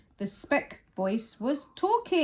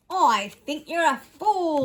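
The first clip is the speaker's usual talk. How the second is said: mocking kangaroo voice, louder; sour kangaroo sneer; 5 semitones higher, 6 dB louder than usual. A lightly voiced kangaroo mocking Horton. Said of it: "laughed the sour kangaroo".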